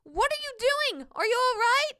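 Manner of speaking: put-on high, anxious boy's call; high-pitched alarmed questions; worried yell. A narrator's reading. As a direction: call out high to check he's safe. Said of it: "I yelled".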